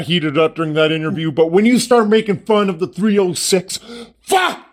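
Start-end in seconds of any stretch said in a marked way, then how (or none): 4.2-4.6 angry outburst. Retold or imitated. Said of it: "I got mad".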